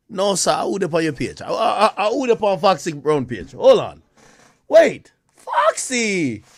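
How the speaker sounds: loud, laughing babble; excited. Real, not acted. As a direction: spill it out loud and giddy.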